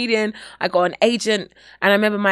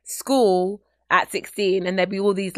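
normal talking voice

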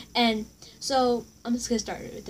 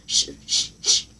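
whispered shh, a touch louder; mock shushing; 3 dB louder than usual. Imitating a classmate shushing her again, whispered shh. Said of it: "she starts saying".

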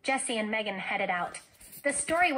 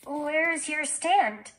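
nervous; 5 semitones higher, 5 dB louder, much slower than usual. A narrator's reading. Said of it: "asked Jessie nervously".

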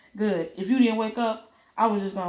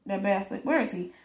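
usual speaking voice

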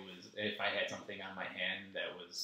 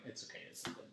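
whispered; 11 dB quieter, faster than usual. Retold or imitated. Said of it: "And I'm like".

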